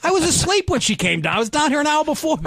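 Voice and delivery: high, fast mock self-defense; retold exasperated excuse; indignant protest. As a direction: plead your innocence to an angry dad, pitch way up. Acted protest to his angry father.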